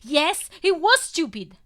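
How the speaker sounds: loud, high, bitter retort; resentful blurt. A narrator's reading. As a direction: throw it back at him. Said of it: "I blurt out resentful".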